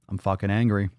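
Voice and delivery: usual speaking voice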